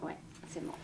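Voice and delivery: soft whispered okay; whispering. Real, not acted. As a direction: breathe it out, barely voiced. Quiet confirmation during measurement.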